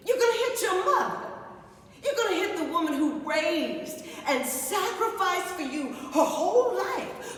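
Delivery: mother daring her daughter to hit her; mother's taunt. Retold or imitated. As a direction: goad her, rising until the dare.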